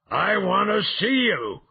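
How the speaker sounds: loud, high beast's shout; brutish bellow. Acted. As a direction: bellow it at someone in hiding. Brute bellowing at hidden man.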